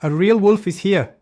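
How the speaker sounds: high, loud frightened begging; desperate plea. Acted. Leo pleading with the village while the wolf is there. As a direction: beg them to believe him. Real wolf, boy begs help.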